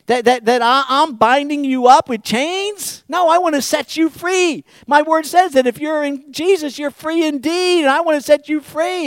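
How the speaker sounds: loud, high, emphatic rebuttal; impassioned, disbelieving God at full volume; incredulous protest. Acted. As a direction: protest the absurd accusation. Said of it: "you're mocking them".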